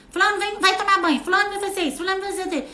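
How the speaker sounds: hammed-up nagging call from afar; mock yelling mother. Shown as a demonstration. Mother yelling orders at child from the kitchen, mock shouting. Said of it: "quando você grita com a criança".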